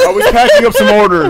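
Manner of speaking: trying to talk through real laughter; laughing. Real, not acted. Still laughing after teasing.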